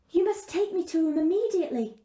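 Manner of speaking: put-on a worried girl asking for help; lightly voiced young girl. Gerda insists.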